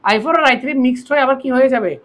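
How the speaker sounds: normal talking voice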